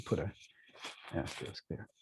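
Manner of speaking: whispered aside. Real, not acted. Self-note muttered aside.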